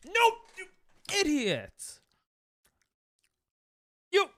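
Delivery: a high, sharp, fed-up cry; exasperated yelp. Real, not acted. Streamer yelping as the catch fails again, exasperated. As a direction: yelp at the escaping Pokemon in disbelief. Pokemon escapes the ball again.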